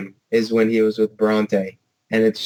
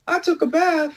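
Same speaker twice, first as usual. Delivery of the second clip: put-on much higher, proud kid voice; little Jack's voice. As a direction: announce it like a proud little boy. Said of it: "wasn't there a part where he's like".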